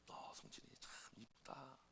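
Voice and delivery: very soft, breath-only murmur of affection; tender praise. Retold or imitated. Teacher strokes the boy's head, tender whispered praise. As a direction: whisper it as a fond teacher would. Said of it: "선생님이 머리 한번 쓰다듬어 주는 거".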